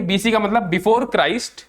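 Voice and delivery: usual speaking voice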